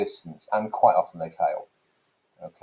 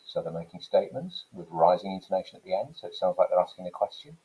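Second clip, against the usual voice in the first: rising intonation at the end of each phrase; uptalk; 3 dB quieter, faster than usual. Shown as a demonstration. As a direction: make statements sound like questions, lifting "end" and "question". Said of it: "that up talk that I've just done there".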